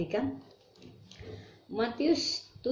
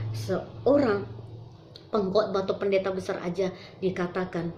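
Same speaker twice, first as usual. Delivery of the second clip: ordinary talk, nothing marked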